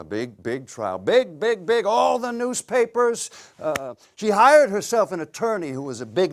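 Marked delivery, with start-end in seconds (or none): 0.0-1.0 low, soft, offhand repeat of big; understated. Acted. Husband recalling wife's trial, low and understated. Same words first low, then built up. 1.0-3.3 rising higher with each big; hyping it up. Acted. Husband boasting of trial in newspapers, hyped up. Same words built up for emphasis.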